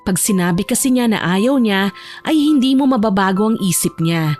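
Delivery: ordinary talk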